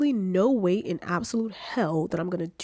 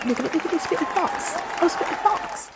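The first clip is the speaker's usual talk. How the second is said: high, playful fake British accent; high, playful British accent bragging to viewers; 10 semitones higher than usual. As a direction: show off in a put-on British accent. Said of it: "where is this British accent coming from".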